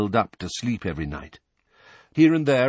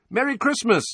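calling out greeting; 14 semitones higher, 3 dB louder than usual. Acted.

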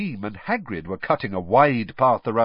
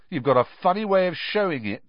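higher, sneering dig; 9 semitones higher than usual. A narrator's reading. Ron jeering at Hermione's loyalty, sneering. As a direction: sneer it at her. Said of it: "sneered Ron".

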